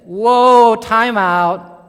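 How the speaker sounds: loud, drawn-out "whoa" of protest; mock alarm. Preacher voices Adam and Eve reacting to Satan, mock alarm. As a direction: call a sudden halt, playacting the shock.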